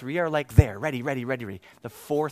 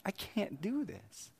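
softer, slow despairing admission; soft, slow and higher, broken down; defeated; 7 semitones higher, 9 dB quieter, slower than usual. Retold or imitated. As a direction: confess you have nothing left. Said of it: "It was more just like".

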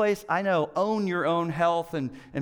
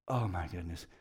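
much softer, lower, sinking dismay; quiet dismay; 10 semitones lower, 9 dB quieter than usual. Retold or imitated. Pastor reliving hearing loss in the other ear, hushed dismay. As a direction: let it fall away, hopeless. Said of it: "And I was like".

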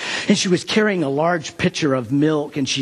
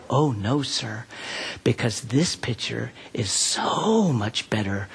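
quiet, lower and hushed; soft child answer; 5 semitones lower, 6 dB quieter than usual. Retold or imitated. Little girl thanking the pastor for the pitcher, quietly. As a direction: answer shyly in a small voice.

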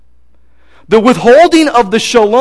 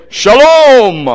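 booming voice; 5 semitones higher, 5 dB louder than usual. Retold or imitated. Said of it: "a little guy with a booming voice".

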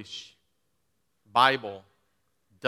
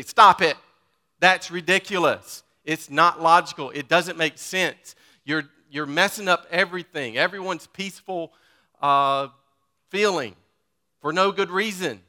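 loud, higher exasperated parent; scolding parent; 5 semitones higher, 7 dB louder than usual. Retold or imitated. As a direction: scold kids fussing over seats. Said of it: "what we say to our children".

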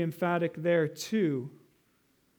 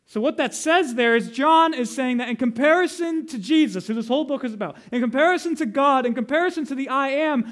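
raised, louder voice building in insistence; higher, forceful pulpit build; impassioned preaching; 4 semitones higher, 6 dB louder than usual. Real, not acted. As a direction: preach it up toward the climax. Sermon builds to its climax.

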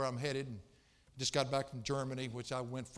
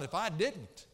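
ordinary talk, nothing marked

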